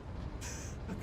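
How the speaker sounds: short breathy laugh; laughing at own voice work; breathy giggle. Real, not acted. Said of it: "I'm trying so hard with these voices".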